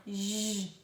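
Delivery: J as a long buzzing "zh"; isolated J sound. Shown as a demonstration. Teacher showing the shared G and J phoneme, J held as "zh". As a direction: sustain "zh", no letter name. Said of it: "Tem o mesmo fonema".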